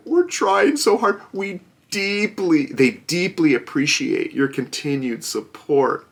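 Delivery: mocking the company's thank-you note; sarcastic company voice. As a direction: ham up the fake gratitude. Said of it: "so now they're like".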